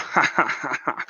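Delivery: mock laugh, acting out his laughter; imitated laughing. Retelling how he cracked up on the live video, imitated laughing. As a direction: put on a long string of laughs. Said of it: "and he's going".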